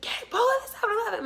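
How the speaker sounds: high, fast frantic order; frantic sister. Retold or imitated. Sister ordering driver into store, frantic. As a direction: bark it like a panicked passenger. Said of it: "So she was like".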